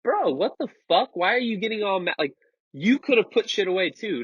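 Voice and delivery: indignant retort. Retold or imitated. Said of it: "And I was like".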